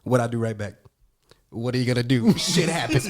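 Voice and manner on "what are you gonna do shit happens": taunting comeback. Retold or imitated. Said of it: "I hit him with that".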